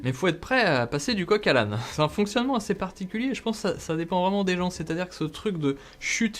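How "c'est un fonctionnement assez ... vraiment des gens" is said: ordinary delivery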